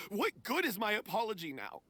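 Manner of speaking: high-pitched, desperate confession; distraught self-blame. Acted. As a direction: beg to be punished, overcome with guilt. Confesses guilt, begs for punishment.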